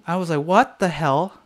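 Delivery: ordinary delivery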